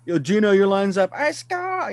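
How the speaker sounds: high, loud hyped bench call; frantic mock sports commentary. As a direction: yell it like a fired-up coach. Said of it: "play by play as he's behind the bench".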